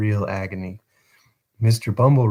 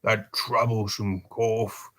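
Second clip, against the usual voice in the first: muttered aside; 7 dB quieter, slower than usual. A narrator's reading. Beadle covers his emotion with a muttered excuse. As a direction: mumble it under your breath. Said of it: "after muttering something about".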